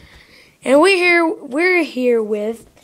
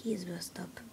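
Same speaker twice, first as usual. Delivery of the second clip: unvoiced gossip; whisper; 8 semitones lower, 19 dB quieter, faster than usual. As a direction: hush it to the camera. Aside to the camera.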